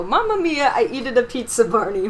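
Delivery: jolly Italian pizza chef; Italian accent. Pretend. Mock Italian after Italians remark.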